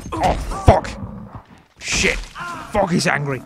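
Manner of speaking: high, loud alarmed cursing; startled yelp. Real, not acted. Player facing an angry animal, startled alarm. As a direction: yelp curses as danger turns on you.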